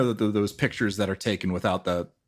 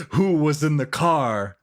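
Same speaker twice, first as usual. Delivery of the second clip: mock dramatic; 8 semitones higher, 5 dB louder than usual. Mock dramatic question, laughing.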